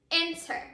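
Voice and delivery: "Enter" with EN stressed and a weaker second syllable; stress on first syllable. Shown as a demonstration. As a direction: put the accent on EN in "Enter". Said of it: "our accented syllable is the first syllable".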